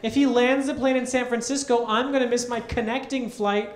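high loud indignant rant; angry complaint. Retold or imitated. Scene: seatmate complains about missing her connection, high loud indignant rant. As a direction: rant like your day is ruined. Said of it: "she turns to me and goes".

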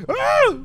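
sudden loud high scream; high shriek. Real, not acted. Gamer shrieking when his character dies, high scream. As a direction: shriek in alarm.

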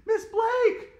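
high, loud calling out; shout. A narrator's reading. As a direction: yell for the teacher. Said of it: "shouted Andrea".

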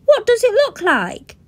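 high, loud sneering retort; spoiled boy scowling; scowling brat. Acted. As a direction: snap back at a silly question. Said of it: "scowled Bradley".

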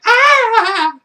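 high, loud mock shriek; girls' scream. Vlogger retelling the film's girls screaming downstairs, in a high, loud mock shriek. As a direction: shriek it like a scared girl. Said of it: "They're like".

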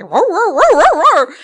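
loud, high mock barking "roar, roar"; imitated dog barking. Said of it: "The dog is like".

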